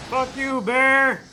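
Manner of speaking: normal talking voice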